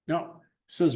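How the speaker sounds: ordinary talk, nothing marked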